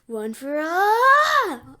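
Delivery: slow, drawn-out, high and loud; power-up call. Acted. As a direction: stretch it out like unleashing power. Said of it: "He's like".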